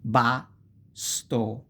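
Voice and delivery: usual speaking voice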